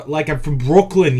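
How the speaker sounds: usual speaking voice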